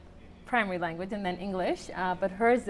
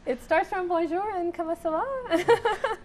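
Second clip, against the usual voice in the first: high, slow, laughing words; laughing through it; 10 semitones higher, slower than usual. Real, not acted. Mother admits to the host her French is basic, laughing. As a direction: giggle over the simple French phrases.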